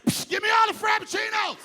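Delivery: high, loud frenzied demand; looter's shout. Acted. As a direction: yell it like you're raiding the counter. Acts out a Starbucks looter.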